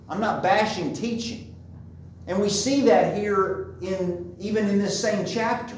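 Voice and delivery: usual speaking voice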